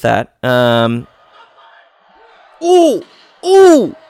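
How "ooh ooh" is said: high, sharp oohs; excited wince. Real, not acted. Reacting to violent punches, high excited oohs. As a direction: wince out loud at each blow.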